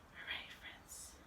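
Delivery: soft unvoiced whisper. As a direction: whisper it to the kids. Whispered aside.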